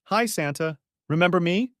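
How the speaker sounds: put-on high little-girl chirp; bright child greeting Santa; a lightly voiced excited little girl. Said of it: "she chirped".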